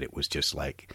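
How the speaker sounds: ordinary talk, nothing marked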